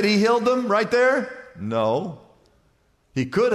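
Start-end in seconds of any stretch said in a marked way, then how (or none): none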